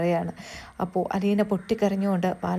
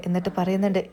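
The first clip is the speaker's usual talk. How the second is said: usual speaking voice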